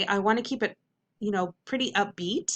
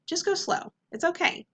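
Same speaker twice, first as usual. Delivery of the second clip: much higher, coaxing teacher voice; reassuring; 9 semitones higher than usual. Shown as a demonstration. Teacher to tongue-tied students, high-pitched and reassuring. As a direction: reassure in a raised, lilting pitch.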